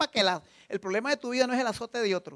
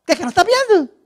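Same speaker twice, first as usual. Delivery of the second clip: mocking retort; 8 semitones higher, 13 dB louder than usual.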